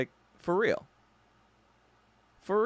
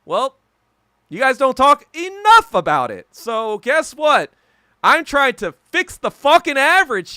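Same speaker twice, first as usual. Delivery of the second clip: loud, high heated rant; retold angry rant; 7 semitones higher, 8 dB louder than usual. Yelling back at comrades who say he talks race too much, loud and heated. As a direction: shout them down, heated and indignant. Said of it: "I was like".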